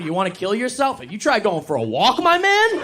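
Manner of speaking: high, loud yelling, building to a screech; aggressive pep talk. Acted. Comic coaching his suicidal buddy, yelling aggressively. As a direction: yell self-care advice like an attack. Mock therapy advice yelled at friend.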